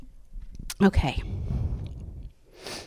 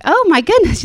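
higher, louder burst of delight; delighted exclaim; 8 semitones higher, 4 dB louder than usual. Retold or imitated. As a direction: gush with amazed joy. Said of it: "I'm like".

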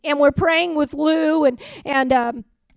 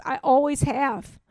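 normal talking voice

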